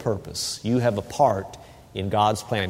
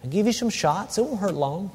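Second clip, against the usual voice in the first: soft, hushed comfort for a trembling pet; calm her down gently, much softer; quiet reassuring murmur; soothing a scared dog; 2 semitones higher, 6 dB quieter than usual. Retold or imitated. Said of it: "I'm saying to her".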